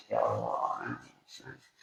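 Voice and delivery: put-on a hushed, breathy child's whimper; soft whispered sobbing for the child; whispered crying. Preacher voicing the child crying in a whisper.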